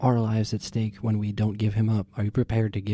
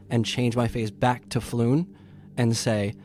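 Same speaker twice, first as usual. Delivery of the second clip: usual speaking voice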